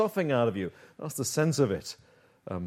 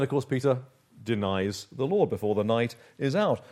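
normal talking voice

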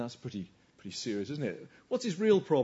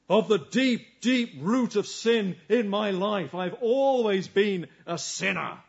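fervent preaching; 4 semitones higher, 4 dB louder, slower than usual. Real, not acted. Preaching at full force.

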